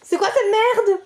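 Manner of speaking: high, exasperated blurt; exasperated outburst. Real, not acted. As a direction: snap at the absurdity. (éclat agacé et aigu)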